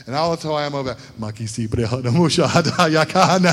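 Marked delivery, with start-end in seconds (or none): 1.2-3.5 rapid run of glossolalia syllables; imitating his own tongues prayer; praying in tongues. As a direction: pray fervently in tongues. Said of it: "I'm praying in tongues".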